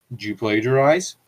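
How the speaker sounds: normal talking voice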